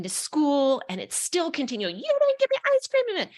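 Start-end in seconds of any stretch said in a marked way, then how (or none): 2.0-3.3 put-on high, loud whining kid voice; a mocking high child's demand for ice cream; whiny child. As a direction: whine it like a cranky little kid. Kids yelling in the car.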